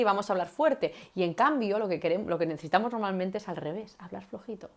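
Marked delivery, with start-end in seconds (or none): 4.0-4.7 much softer, almost hushed; hushed demo voice. Shown as a demonstration. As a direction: drop right down to show speaking softly.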